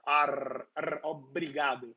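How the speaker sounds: "ar, ar", then "obrigado" with the same dry R; drier R demo. Shown as a demonstration. Brazilian drills viewers on "ar, ar, obrigado" with a dry R. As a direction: isolate the tongue-tip R in "ar", then put it into "obrigado". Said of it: "with this drier R".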